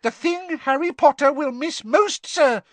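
high, loud elf squeak; squeaky house elf. Acted. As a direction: squeak it out urgently. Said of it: "squeaked Dobby".

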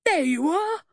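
high, loud triumphant cry; squealing, gleeful vindication; exultant. A narrator's reading. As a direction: crow it in triumph. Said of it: "the man exulted".

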